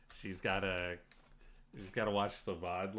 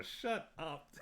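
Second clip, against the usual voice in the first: mock annoyed; 8 semitones higher, 5 dB quieter than usual. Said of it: "I'm like".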